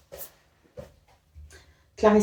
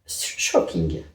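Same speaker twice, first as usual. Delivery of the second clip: a quick, playful put-on English accent, barely voiced; mock English accent; 5 dB quieter, much slower than usual. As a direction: say it like a Brit, half-joking. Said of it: "Pardon pour mon accent". (accent anglais pour rire, à peine soufflé)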